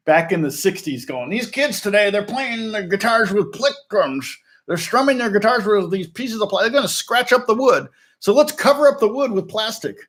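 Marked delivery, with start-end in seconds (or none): 1.3-10.0 put-on old man voice. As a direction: play a fussy old Gibson executive. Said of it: "you like my old man voice?".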